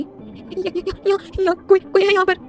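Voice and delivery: put-on terrified girl struggling to get words out; panicked stammer. A narrator's reading. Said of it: "पंछी घबराई हुई सी बोली".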